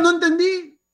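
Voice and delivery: un toque de alumno agudo y tímido; alumno apenado. Retold or imitated. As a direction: confiesa a medias. Alumno confesando que no entendió. (leve voz aguda de alumno)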